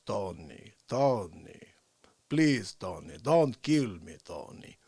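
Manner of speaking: put-on low, accented begging; pleading victim. Gangster's victim begging Tony for mercy, low plea. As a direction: plead for your life in a low voice.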